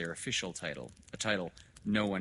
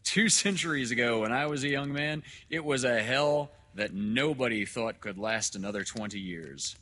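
loud, higher reminiscence, settling at the end; declaiming doctor; 5 semitones higher, 9 dB louder than usual. Acted. Doctor recalling his youth to father, loud oratory. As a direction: recall it forcefully, then drop off. Dr. Hitz, a figure in the story.

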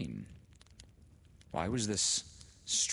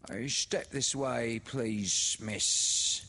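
higher, louder, slow and ingratiating; oily voice; 6 semitones higher, 6 dB louder, much slower than usual. A narrator's reading. Little woman leads Laura inside, oily and slow. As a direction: smarm it, slow and sly. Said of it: "in an oily voice".

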